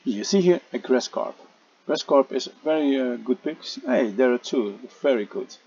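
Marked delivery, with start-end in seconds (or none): none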